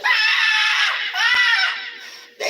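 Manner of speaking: long high-pitched shriek; loud falsetto scream, held; mock hysterical screech; imitated piercing scream.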